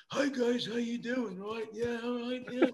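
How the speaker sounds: high, dazed and friendly. Retold or imitated. Imitating a stoned [name] greeting the kids, high and dazed. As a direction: play a rock star who's out of it, up high. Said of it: "he's whacked out of his head".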